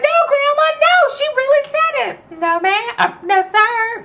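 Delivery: put-on very high, pleading child voice; pleading kid. Boy begging grandma to spare him, very high and pleading. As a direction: beg like a scared eight-year-old. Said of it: "I was like".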